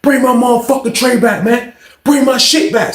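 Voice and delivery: high-pitched, insistent demand; imitated angry hollering. Hungry inmate demanding his tray back, mock angry holler. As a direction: holler down the tier, acting out outrage. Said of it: "he start making a bunch of noise".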